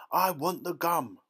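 high, loud, stubborn demand; obstinate. A narrator's reading. Violet insisting to her mother, obstinate. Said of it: "Violet said obstinately".

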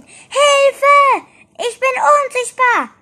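very high, loud cry of alarm; frightened shout. A narrator's reading. Ette discovers he is invisible, frightened shout. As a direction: yell it out in high-pitched panic. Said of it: "rief er erschrocken".

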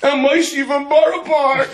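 put-on high, slow small boy voice, laughing; hammed-up little boy announcing himself; little kid. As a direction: squeak it out like a proud little kid. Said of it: "He goes".

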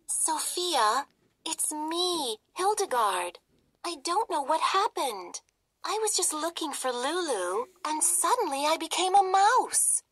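high squeaky mouse voice; a little mouse pleading to be known; tiny mouse princess. Acted. Mouse Hildy explains.

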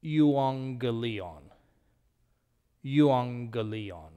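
slow "Euangelion" with ng for the double g; first g as n. Shown as a demonstration. Teacher repeats the Greek word slowly for students, ng sound. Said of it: "The first G takes on the N sound".

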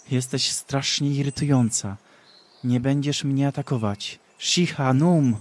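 annoyed shout; irritated. Acted. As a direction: snap back at her, annoyed. Ashera angry, casts spell.

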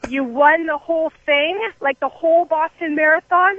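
can't believe what he's hearing; stunned disbelief. Retold or imitated. Shocked reply to marathon winner.

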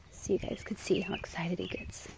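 hushed whisper to the viewers; whispered aside. Real, not acted. Whispering to viewers while filming the horse. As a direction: keep it to a breath so he won't hear.

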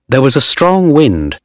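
usual speaking voice

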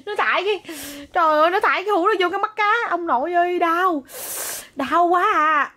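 high, fast pained complaint; real pain complaint. Real, not acted. Mother telling off her toddler for hurting her, high pained voice. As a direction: wail about the sore ankle.

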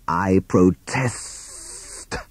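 long drawn-out sibilant hiss; very slow, hissed snake protest. A narrator's reading. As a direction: stretch every S like a snake. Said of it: "hissed Hissing Sid".

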